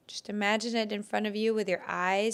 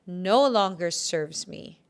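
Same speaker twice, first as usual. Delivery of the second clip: louder, slow, firm affirmation; slow declaration; 9 dB louder, slower than usual. Shown as a demonstration. Healer repeats the release one more time, slow loud declaration. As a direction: declare it loudly, word by word. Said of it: "Do it one more time".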